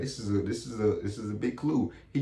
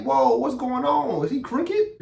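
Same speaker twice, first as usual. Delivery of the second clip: high, slow shocked voice, climbing; shocked disbelief; 12 semitones higher, 3 dB louder than usual. Retold or imitated. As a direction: gasp at the news he is crooked. Retold shock at crooked cop.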